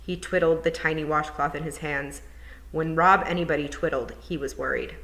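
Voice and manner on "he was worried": ordinary delivery, nothing marked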